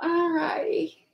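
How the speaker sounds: choked-up, breathless and slow; holding back real tears; tearful whisper. Real, not acted. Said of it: "I'll be bawling".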